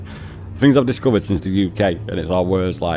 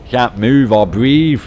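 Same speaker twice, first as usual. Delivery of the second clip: mock gasping; 4 semitones higher, 7 dB louder, much slower than usual. Mock suffering in heat.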